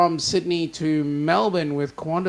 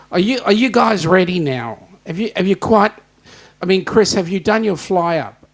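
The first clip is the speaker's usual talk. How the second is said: louder, jokey ribbing of Chris; teasing call; 3 semitones higher, 7 dB louder than usual. Real, not acted. Host teasing Chris about his fly before the guests come on, loud. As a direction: call out to rib them.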